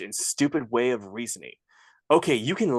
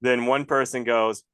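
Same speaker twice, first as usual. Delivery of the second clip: normal talking voice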